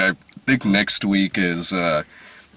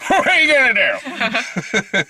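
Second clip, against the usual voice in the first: Ben Affleck impression. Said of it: "someone just does the Ben Affleck, like".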